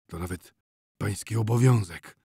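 sombre, understated reply; a touch of gloom; gloomy. A narrator's reading. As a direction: state it grimly. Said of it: "ponuro odpowiedział Kosiba".

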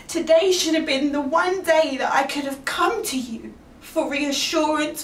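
usual speaking voice

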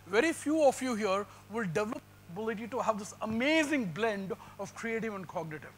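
ordinary delivery

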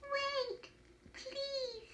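high, soft little elf voice; a small, drawn-out plea, high and soft. A narrator's reading. Small elf calls to the woodcutter to wait, high small voice. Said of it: "he heard a small voice say".

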